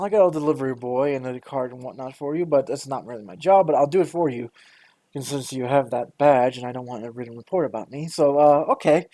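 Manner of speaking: usual speaking voice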